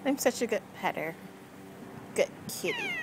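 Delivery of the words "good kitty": cooing. Real, not acted. Cooing at the cat.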